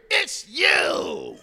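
loud surprised call across the room; officer calling out loudly in recognition. Retold or imitated. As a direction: shout it like spotting an old foe. Officer recognizes him across lunchroom.

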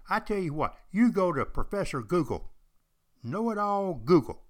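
loud, high mocking jab at know-it-all Google; mock scorn. Real, not acted. Telling podcast listeners not to trust Google, loud mocking scorn. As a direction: scoff at the know-it-all search engine.

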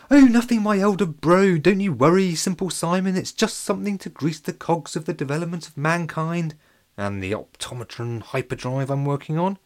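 sly cover-up. A narrator's reading. Joe covering up slyly.

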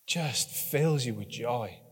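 soft sighing delight; soft wonder. Real, not acted. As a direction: sigh it out with joy. Wonder at baby smell.

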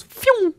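very high-pitched imitated "phew"; quick high whoosh; whooshing sound effect. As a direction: mimic something shooting off fast. Imitates released penguin shooting off.

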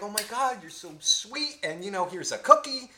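a hint of a gushy, grateful mom; imagined grateful mother. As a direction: imitate a mother heaping on thanks. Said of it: "looking at the mother for, like, support".

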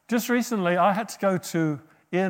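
normal talking voice

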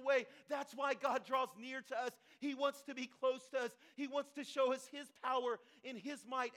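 impassioned preaching. Acted. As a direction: drive each promise home loudly. Preaches at full force.

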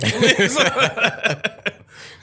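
high, loud, pleading cry of a friend; mock alarm from a buddy holding you back; pleading Stanley. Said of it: "I'll be like your man Stanley".

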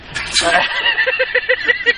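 shrill giggle way up high; high squeaky laugh. Real, not acted.

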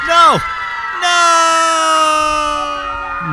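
high, loud panicked no; terrified scream. Acted. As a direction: scream as the mob charges. Mob charges at Mike.

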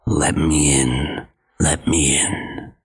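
low, slow wolf voice; big bad wolf calling sweetly. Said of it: "calling out".

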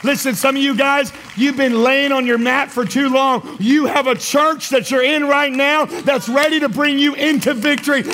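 high, rousing preacher's exhortation; full-force preaching. Real, not acted.

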